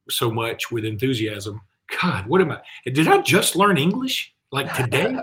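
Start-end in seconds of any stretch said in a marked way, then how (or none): 2.0-5.2 higher, louder, incredulous at himself; self-mocking exasperation. Real, not acted. Host who just garbled his question to co-host, self-mocking exasperation. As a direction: make fun of your own fumbled sentence.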